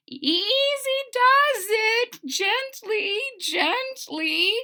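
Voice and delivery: high, slow gentle coaxing. Acted. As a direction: ease them along carefully, high. Coaxing carefully.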